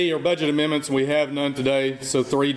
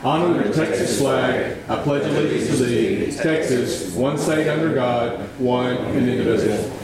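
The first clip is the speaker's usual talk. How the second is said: pledge recitation. Acted.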